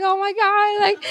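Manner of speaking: high-pitched, overwhelmed mock wail; imitated crying. Singer reliving tears before a chanting crowd, imitated crying. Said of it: "so I was crying more".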